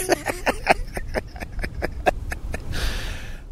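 long, soft, wheezy laugh; laughing at himself. Real, not acted. Host after dodging saying business twice, long laugh. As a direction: crack up quietly at your own slip. Laughs at his own word slip.

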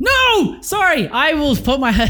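loud, high-pitched outcry; shouted protest. Real, not acted. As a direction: cry out in real objection. Protesting friend's verdict on a film.

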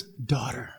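soft, low, tender naming; tender address. Acted. Jesus calls the healed woman daughter, tender and hushed. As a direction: say it gently, dropped low and soft.

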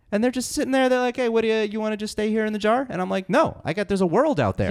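high, chirpy little germ voice; cute germ voice. Acted. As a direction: play a tiny eager virus. Virus character telling his story.

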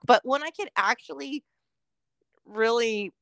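usual speaking voice

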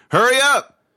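high, loud yell; urgent cry. A narrator's reading. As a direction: shout it urgently across the river. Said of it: "Lee cried".

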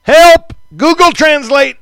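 high, loud pretend plea; mock cry for help. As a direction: call out in comic desperation.

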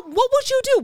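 high-pitched mock alarm; hammed-up nagging question; complaining shopper. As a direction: imitate a customer fussing in a high voice. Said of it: "Like, she's like".

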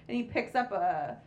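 normal talking voice

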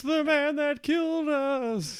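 ghost voice. Pretend. Ghosts accusing Naismith.

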